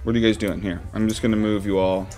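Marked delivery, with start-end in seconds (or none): none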